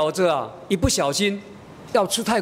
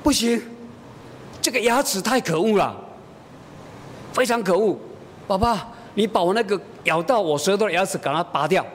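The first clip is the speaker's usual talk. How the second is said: higher-pitched mock childish complaint; indignant child; 5 semitones higher than usual. Hurt daughter demanding father pull the tooth, indignant child. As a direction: imitate a small girl's stubborn demand.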